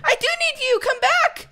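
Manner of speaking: very high-pitched call after it; urgent calling. Real, not acted. Player calling back what they need, high and urgent. Calls back something needed.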